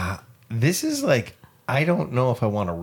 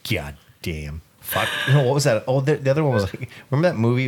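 ordinary talk

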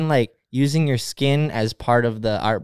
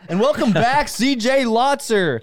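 mock announcer; 8 semitones higher, slower than usual. Mock announcer welcoming friend back.

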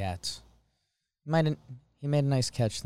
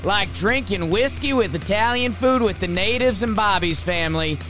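higher-pitched, punchy highlight-reel delivery; hyped game recap; 8 semitones higher, 4 dB louder than usual. Pretend. Co-host recapping a Yankees win over music, hyped announcer style. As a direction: race through the game like an excited sports announcer.

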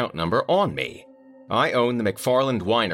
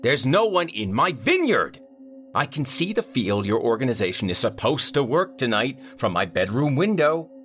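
higher, louder irate caller; angry client; 9 semitones higher, 4 dB louder than usual. A narrator's reading. Vineyard owner complaining to the manager, irate. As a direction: demand answers, fed up.

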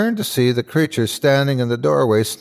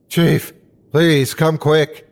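urgent plea; 5 semitones higher, 3 dB louder, slower than usual. A narrator's reading. Frantic emergency call for help.

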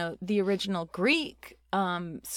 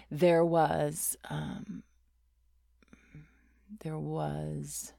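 stalling for names; 4 semitones lower, much slower than usual. Retold or imitated. Pastor asked to name female disciples, stalling. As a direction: trail off into long, empty pauses. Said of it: "I was, like, waiting".

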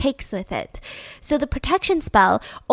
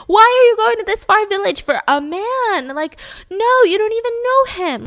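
high, loud voice of alarmed relatives; shrill panic of a family stopping her; worried family; 11 semitones higher, 5 dB louder than usual. Retold or imitated. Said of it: "They're gonna be like".

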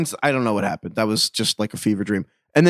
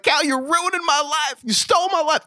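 Ricky Bobby quote; 12 semitones higher than usual. Retold or imitated. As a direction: whine it like the wronged movie husband. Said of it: "He's like".

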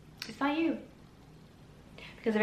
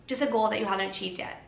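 usual speaking voice

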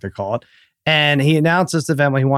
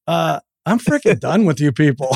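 mock fed-up son; 2 semitones higher than usual. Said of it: "I see Archie is like".

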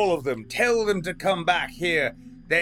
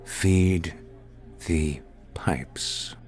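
snarled whisper; much slower than usual. A narrator's reading. The waiter, leaning into Ian's space, snarling a whispered threat. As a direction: hiss the threat into his face. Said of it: "practically snarled it at Ian".

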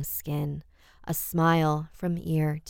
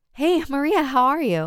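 bright, much higher eager hello into the phone; hopeful greeting; 10 semitones higher, 6 dB louder than usual. Acted. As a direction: answer the call hoping it's your friend. Hoping it's Maria on phone.

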